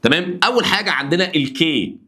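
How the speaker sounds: ordinary talk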